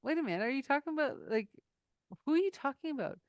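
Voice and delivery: fast, high, puzzled rush; confused outburst. Retold or imitated. Friend confused by name in group, asks fast and puzzled. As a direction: sound baffled, cutting in quickly. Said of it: "I actually had to say".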